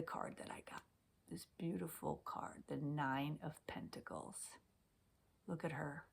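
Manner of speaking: soft, reverent whisper over the card; hushed whisper. Real, not acted. Tarot reader showing her card to viewers, whispering. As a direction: breathe it like a secret.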